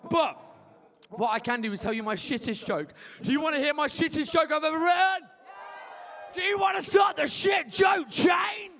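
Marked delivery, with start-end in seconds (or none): 3.2-8.7 hyped shout. Real, not acted. MC hyping shit joke chain.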